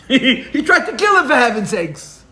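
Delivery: half-laughing outrage at the idea; laughing exasperation. Real, not acted.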